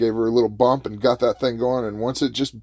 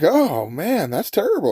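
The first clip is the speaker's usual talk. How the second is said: higher-pitched "oh, man" commiseration; sympathetic, pitched up; acted sympathy; 8 semitones higher than usual. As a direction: commiserate with a stranded stranger. Acted sympathy for stranded driver.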